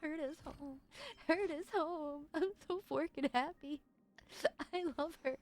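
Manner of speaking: high, giddy squeal of delight; giddy joy. Real, not acted. Fan overjoyed at getting the new character, giddy.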